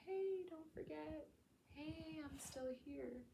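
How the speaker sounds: high, slow and soft little echo voice; faint echo. Pretend. Echo of the past self calling softly, high and slow. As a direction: call softly from far back. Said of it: "this echo that's saying".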